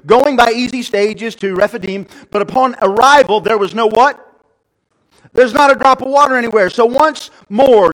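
loud, high-pitched scripture reading; forceful preaching. Real, not acted. Preacher reading of the waterless arrival, forceful. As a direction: belt out the Bible story.

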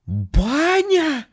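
very high shocked cry; horrified gasp of a word. Acted. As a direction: recoil in horror. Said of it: "ужаснулся голос". (в ужасе)

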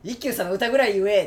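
loud, high mock order; emphatic command. Quoting radio host's advice on saying love, loud and emphatic. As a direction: belt it out as a quoted demand. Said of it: "って言ってて".

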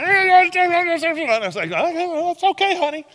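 put-on high toddler voice. Father mimics daughter in a high squeaky toddler voice. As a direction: play the toddler mimicking her dad in a tiny squeal. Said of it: "and she'd be like".

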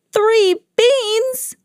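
squeaky high disbelieving cry of a cartoon farmer. Farmers to Mickey, a shrill indignant cry. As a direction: shriek it in comic disbelief. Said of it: "they cried".

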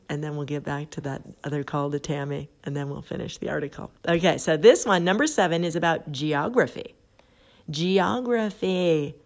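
ordinary talk

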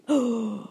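sharp, sudden intake of breath; shocked gasp. Retold or imitated. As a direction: gasp as if hearing terrible news. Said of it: "I gasped".

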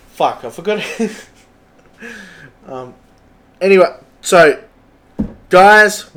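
normal talking voice

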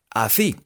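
"Así" as "athí"; lisping. Shown as a demonstration. Teacher showing the lisping cat's speech, lisped "Así". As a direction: lisp the s in "Así" with tongue on teeth. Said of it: "como si fuera lengua y sopita".

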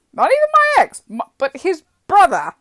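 loud, high-pitched disbelief; incredulous emphasis. Real, not acted. Driver on her ex's brother's prying, incredulous. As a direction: burst out in amazed exasperation.

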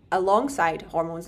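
usual speaking voice

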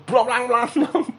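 high, loud clang-clang imitation; clattering noise. Telling listeners how the taxi sign fell, imitated clatter.

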